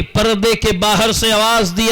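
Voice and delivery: ordinary talk, nothing marked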